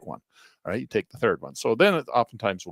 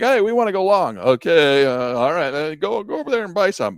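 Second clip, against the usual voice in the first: a hint of a pushy trader, higher and louder; hammed-up boardroom order, voice pitched up; mock hedge fund trader; 7 semitones higher, 6 dB louder than usual.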